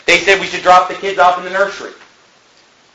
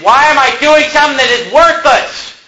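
raised, high-pitched exasperated protest; retold angry yell; 8 semitones higher, 3 dB louder than usual. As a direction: re-enact yelling in frustration. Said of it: "I was yelling".

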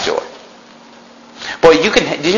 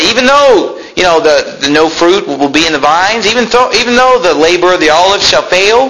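building preaching; 6 semitones higher than usual. Acted.

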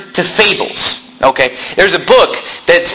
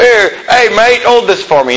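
lightly mimicked Irish passenger, higher pitched; Irish accent; 4 semitones higher than usual. Irish guy asks preacher to hold his drink, mock Irish accent. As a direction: imitate an Irish guy asking a favor, pitched up. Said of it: "You know, this Irish guy".

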